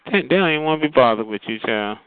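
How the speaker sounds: ordinary talk, nothing marked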